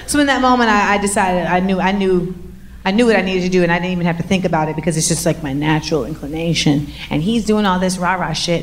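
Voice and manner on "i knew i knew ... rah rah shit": usual speaking voice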